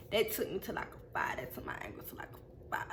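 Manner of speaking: near-whispered, very soft aside; barely voiced, under control; hushed recounting. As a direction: drop to a whisper, holding anger in check. Anger rising from zero to five.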